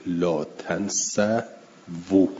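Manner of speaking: «لا تنسوا» drawn out very slowly, long final waw; very slow demo. Shown as a demonstration. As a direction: stretch «تنسوا» slowly to show the plural waw. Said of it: "اینجا واو واو جمعه". («تنسوا» کشیده و آهسته)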